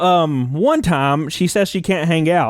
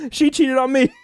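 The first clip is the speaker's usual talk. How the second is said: laughing; 8 semitones higher than usual. Real, not acted. Laugh heard on line.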